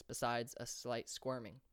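plain reading voice

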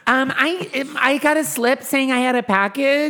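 high customer voice. Pretend. Student at the mail counter asking for her package, high put-on voice.

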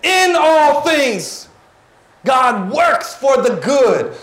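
full-force preacher declaration; preaching shout. Real, not acted. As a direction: drive it home to the church. Preacher's climactic proclamation.

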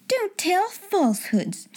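high, slow scolding; cold stern rebuke; angry schoolmistress. Acted. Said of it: "she was angry enough".

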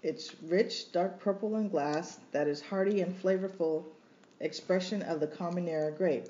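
reading review aloud. Retold or imitated. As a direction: read it out with relish. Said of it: "Oh, this one".

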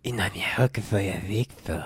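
soft breathy imitation of a seductive whisper; mock whisper. Mocking the whispering video guy. (susurro burlón)

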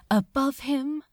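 ordinary delivery, nothing marked

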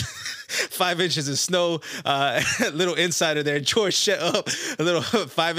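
talking through real giggles; laughing. Real, not acted. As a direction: try to get it out while cracking up. Cracking up at an inside joke.

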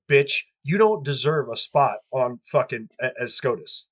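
ordinary talk

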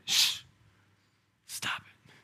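whispered shh, stop it. Acted. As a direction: hush it gently in a whisper. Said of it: "Jesus will ... be like".

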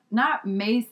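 ordinary talk, nothing marked

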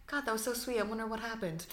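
hushed wondering aside. Retold or imitated. Coworker privately wondering about the flowers, hushed. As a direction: murmur it as if thinking to yourself.

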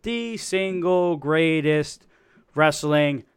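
booming ringmaster announcer. Acted. Announcer intro.

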